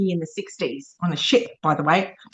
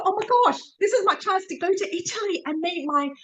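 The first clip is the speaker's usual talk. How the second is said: high, thrilled gasp of joy; oh my gosh excitement, voice jumping high; excited inner thought; 6 semitones higher than usual. Retold or imitated. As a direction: relive the moment the news hit, pitch way up. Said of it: "I thought".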